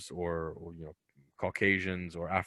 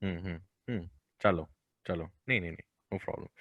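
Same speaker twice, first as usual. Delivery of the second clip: put-on light Indian accent, murmured; casual Indian accent with soft fillers; 2 semitones lower, 6 dB quieter than usual. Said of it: "speaking Hindi to me".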